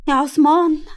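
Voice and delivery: exasperated firm call of his name; annoyed and stern. Acted. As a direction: scold your brother sternly. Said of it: "قالتها بضيق أكتر في قلب صرامة".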